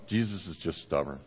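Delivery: soft, low retold complaint; subdued grumble. Father quoting his son's morning complaint, low and subdued. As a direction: let the quoted grumble sink away quietly.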